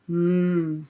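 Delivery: ordinary delivery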